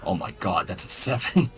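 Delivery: ordinary talk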